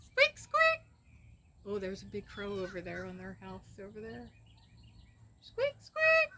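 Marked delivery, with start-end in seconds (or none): 0.1-0.8 very high, loud squeaky voice; squeaky pet call. Real, not acted. Owner calling pet for dinner, squeaky calling. 5.4-6.3 very high, loud squeaky voice; squeaky pet call. Real, not acted. Owner calling pet for dinner, squeaky calling.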